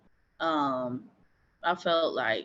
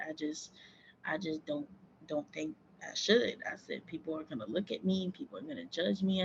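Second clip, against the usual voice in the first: quiet hesitant; 8 dB quieter than usual. Retold or imitated. Retelling fearful words to dad.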